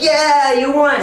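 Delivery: high, excited cheer; cheering a winner. Pretend. Teacher cheering a kid who won the game, excited cheer. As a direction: cheer them on, pitched well up.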